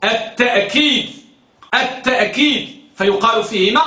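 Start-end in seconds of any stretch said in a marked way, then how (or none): 0.0-2.8 التأكيد with a clear glottal stop, ta'-keed; with hamza. Shown as a demonstration. Teacher models the hamza variant for students, hamza pronunciation. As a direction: break after ta in التأكيد. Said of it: "لغة الهمزة".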